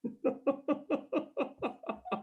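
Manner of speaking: soft, high-pitched stifled giggle; helpless quiet laughter, pitched high; real giggling fit. Real, not acted. Cracks up after explaining the joke.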